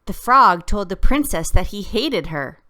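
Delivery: anger. Shown as a demonstration. Teacher reading a fairy tale line with anger. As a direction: spit it out, outraged.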